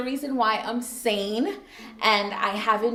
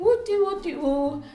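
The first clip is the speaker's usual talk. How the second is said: higher-pitched, playful nonsense singsong; carefree singsong; 9 semitones higher, 3 dB louder than usual. Retold or imitated. Child coming home from school without a care, playful singsong. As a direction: breeze in with a silly little singsong.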